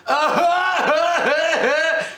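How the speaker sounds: high, braying mock laugh; obnoxious donkey laugh. Moviegoer imitates the mom's laugh, high donkey bray. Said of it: "obnoxious donkey laugh".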